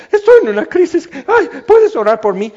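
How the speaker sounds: high, loud hammed-up distress; frantic plea. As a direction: beg for prayer in mock panic. Someone in crisis asking prayer.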